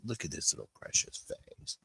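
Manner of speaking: soft whisper over a sleepy puppy. Real, not acted. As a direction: breathe the words without voice.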